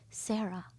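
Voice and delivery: very soft, fading single name; dying father recognizing his daughter; faint whisper of name. Retold or imitated. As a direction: breathe her name out weakly. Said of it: "he goes".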